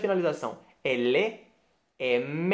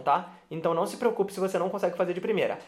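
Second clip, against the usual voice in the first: ordinary talk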